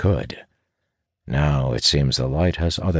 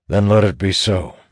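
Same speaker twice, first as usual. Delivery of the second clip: slightly raised, louder declaration; decisive leader; 4 semitones higher, 5 dB louder than usual. A narrator's reading. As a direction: seal the decision firmly.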